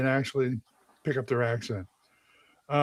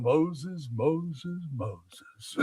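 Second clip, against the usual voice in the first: slow, hushed repeated name; hammed-up soft regal intoning; throne voice; 2 semitones lower, 10 dB quieter, much slower than usual. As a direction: intone it softly, fading away. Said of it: "I sit there on the throne".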